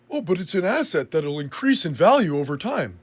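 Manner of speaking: put-on voice with swooping pitch; mocking objector. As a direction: parrot a naive argument in a mocking singsong. Mimics the opposing argument, then rebuts it.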